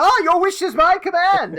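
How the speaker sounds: high, loud, grand genie proclamation; a wizard hamming up his grand reply to a breakfast order. Pretend. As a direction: boom it out like a magic servant granting wishes. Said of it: "It's like".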